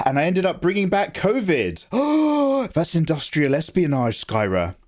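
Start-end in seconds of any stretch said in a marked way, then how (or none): 1.9-2.7 sharp high mock gasp. Streamer gasps in mock horror at chat news, sharp high gasp.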